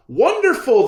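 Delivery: high, loud excited cry; crowd cheering. Acted. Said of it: "the people cried".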